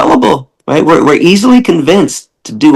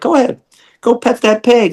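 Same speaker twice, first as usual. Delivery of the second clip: much higher, teasing push; high mock urging; 10 semitones higher than usual. [name] urging a meat eater to pet the pig, high and teasing. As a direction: egg the visitor on in a lifted voice. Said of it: "I'm like".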